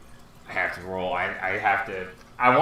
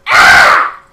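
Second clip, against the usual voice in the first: creature gasp; 16 dB louder than usual. Acted. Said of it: "looks over and goes".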